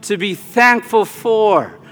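leading the congregation in prayer. Acted. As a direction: address the Lord with growing fervor. Leads a prayer.

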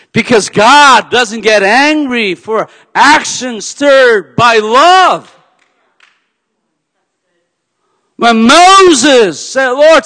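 loud, high, slow preacher's cry; full-force preaching. Acted. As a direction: thunder it from the pulpit, stretched out.

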